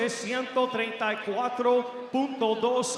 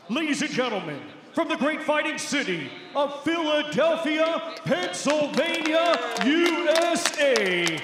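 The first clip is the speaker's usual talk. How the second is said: building to a booming fighter introduction, higher and louder; ring announcer; 6 semitones higher, 4 dB louder than usual. Acted. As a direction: stretch the name out, big arena call.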